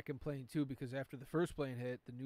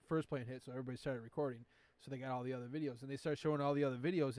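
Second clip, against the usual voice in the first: ordinary talk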